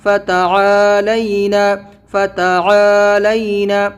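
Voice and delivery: slow, عا in فتعالینا drawn out; stretch the ain. Shown as a demonstration. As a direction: hold عا long, keep لینا light. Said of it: "عین کو کھینچنا ہے". (فتعالینا میں عین کو کھینچ کر)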